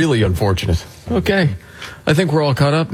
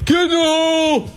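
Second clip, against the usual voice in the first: yelling high and long, mocking; mock golf-fan yell. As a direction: imitate an obnoxious fan hollering from the gallery. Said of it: "anybody that yells".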